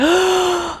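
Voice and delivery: a sudden high gasp for air; sharp gasp. Retold or imitated. Grabbing the stair railing in chest pain, a sharp gasp. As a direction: catch your breath in a sharp gasp.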